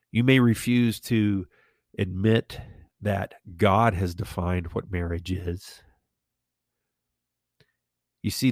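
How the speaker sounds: ordinary delivery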